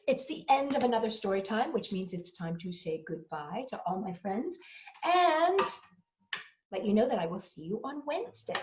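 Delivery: usual speaking voice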